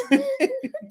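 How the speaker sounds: a burst of high laughter after the story; high-pitched laugh. Real, not acted.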